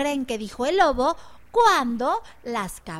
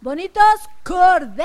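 louder emphatic answer; 4 semitones higher, 7 dB louder than usual. Shown as a demonstration. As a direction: call out the answer loudly. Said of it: "A ver, díganme".

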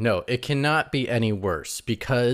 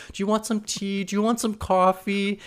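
sweet Sandra; 12 semitones higher than usual. Retold or imitated. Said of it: "then she's like".

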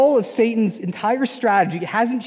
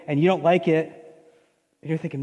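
normal talking voice

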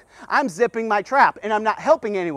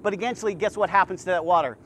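quiet earnest preaching; 4 semitones lower, 4 dB quieter than usual. Acted. Preacher confronting the congregation, quiet and earnest. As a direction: lower and softer, confiding sermon voice.

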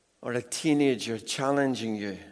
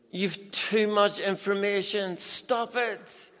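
passionate rant; 6 semitones higher than usual. Real, not acted. Preacher ranting at parents about Googling. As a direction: rant at the congregation.

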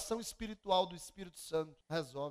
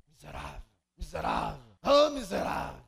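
bitter muttering; 3 semitones lower, 11 dB louder than usual. Retold or imitated. Bitter neighbour muttering curses.

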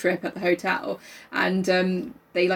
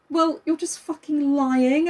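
slow retort; 6 semitones higher, much slower than usual. Retold or imitated. Retold retort to teacher.

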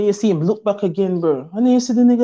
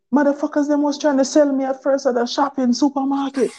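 crocodile telling stories; 5 semitones higher than usual. Pretend. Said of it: "that motherfucker probably telling stories down there".

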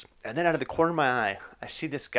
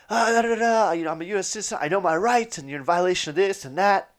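higher, heated mock rant; going off; 7 semitones higher than usual. Grandpa ranting at the border agents, mock heated. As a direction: rant about your rights, raised. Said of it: "My grandpa starts going off".

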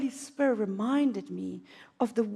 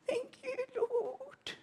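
high, soft, slow weepy thanks; crying thanks; 9 dB quieter, much slower than usual. Retold or imitated. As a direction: cry it out gratefully. Tearful thanks to God.

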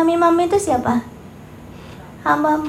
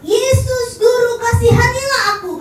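crying out for mercy; 7 semitones higher than usual. Acted. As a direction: call out as if begging from far away. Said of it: "Terus teriak".

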